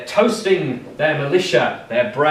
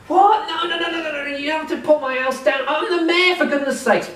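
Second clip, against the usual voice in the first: much higher, frantic pleading; panicked mayor; 12 semitones higher than usual. Acted. Terrified mayor pleads with the captain not to pull his house down. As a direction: beg in a rising panic.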